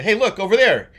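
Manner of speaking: loud excited call to the family. Retold or imitated. Father calling out corn to the car. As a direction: point it out eagerly. Father calls out on road trip.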